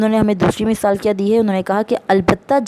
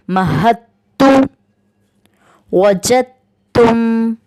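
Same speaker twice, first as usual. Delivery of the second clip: louder, drawn-out chanted recitation of each example; slow loud recitation; 3 semitones higher, 3 dB louder, much slower than usual. Shown as a demonstration. Teacher reciting examples twice for students, loud slow chant. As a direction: recite each word slowly and firmly, repeating it. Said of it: "teacher reciting examples".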